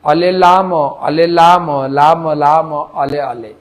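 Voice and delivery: put-on Burmese accent, "ole lamo". Teacher imitates devotees in Burma, Burmese accent with l for r. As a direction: chant it Burmese-style, every r an l. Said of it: "র কে ল উচ্চারণ করে".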